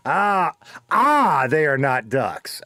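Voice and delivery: loud, high Southern twang; slow hammy drawl; Southern accent. Pretend. Ducks joke punchline.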